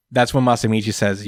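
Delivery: ordinary talk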